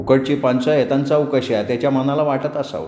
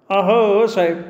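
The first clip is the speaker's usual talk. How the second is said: frightened plea; 6 semitones higher, slower than usual. A narrator's reading. Frightened shopkeeper pleads.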